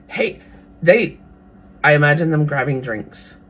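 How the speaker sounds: usual speaking voice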